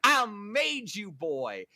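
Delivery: high, slow mocking voice; drawn-out sneering imitation; mocking impression. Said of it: "he's just like".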